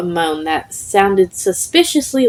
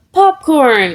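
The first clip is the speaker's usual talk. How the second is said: bright eager shout of a name, higher; excited call; 7 semitones higher, 4 dB louder than usual. Acted. Izuku greets the ghost, calling out excitedly. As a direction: call out to a friend with joy. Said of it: "Izuku called out excitedly".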